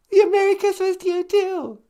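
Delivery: high fast squeaky wife. Acted. Wife returning the caller's greeting, high and fast.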